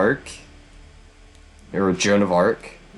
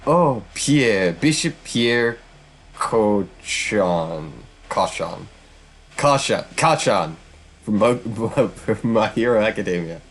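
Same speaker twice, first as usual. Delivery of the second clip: ordinary talk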